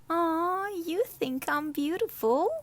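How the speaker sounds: high, pleased coo; light up at the compliment; delighted. Acted. Martina coos at Perez's compliment, high and delighted.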